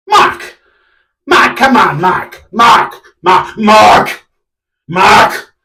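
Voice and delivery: repeated pleading shouts of the name; exasperated calling. Acted. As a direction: call him out, fed up. Calling out to owner.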